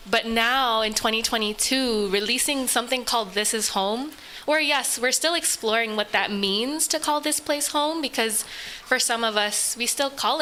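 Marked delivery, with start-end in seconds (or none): none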